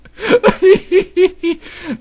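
high, loud mad cackle; villainous cackle. A narrator's reading. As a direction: cackle in a long shrill run. Miyuki's mocking laugh in story.

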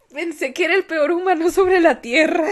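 loud laughing relief; real loud laugh of relief; relieved laughter. Real, not acted. Relief after fearing he killed it.

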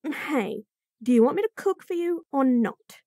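ordinary talk, nothing marked

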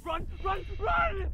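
rising yell to run; frantic shout. Acted. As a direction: scream at them to flee. Character shouts during chase.

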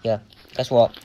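usual speaking voice

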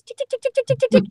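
quick high clicky tick tick imitating a knife; chopping sound effect. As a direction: mimic rapid knife chops on a board.

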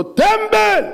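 high, explosive shout; sudden furious outburst, high-pitched; yelled insult. Acted. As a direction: yell it at a fool. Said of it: "צועק עליו".

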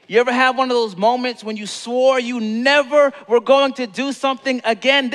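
ordinary talk, nothing marked

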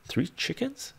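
can't believe the number; shocked repeat; incredulous. Real, not acted. Disbelief at diet.

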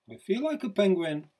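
high bright little penguin voice; squawky bouncy penguin. Acted. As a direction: chirp it up high like a small bird. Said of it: "said Blue Penguin".

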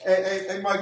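ordinary talk

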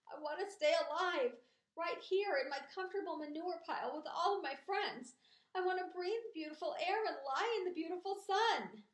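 high, loud, wailing lament; moaned. A narrator's reading. Tearful pig longing to stay alive, moaned. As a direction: pour out a tearful, high plea. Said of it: "he moaned".

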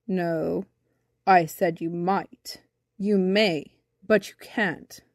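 low, measured correction; deliberate bumblebee. A narrator's reading. Bumblebee corrects protesting boy, low and deliberate. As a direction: spell out the distinction patiently. Bumblebee explains may versus can.

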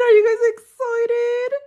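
high, slow singsong hype; excited. Real, not acted. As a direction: draw out the question to hype viewers. Hyping up viewers.

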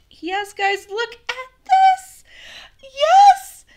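high, loud, drawn-out delight; excited squeal. Real, not acted. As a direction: show them off squealing. Showing off her new ears.

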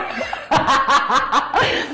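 a high burst of laughter; real laugh. Real, not acted. Laughing right after quoting her plea to her mother.

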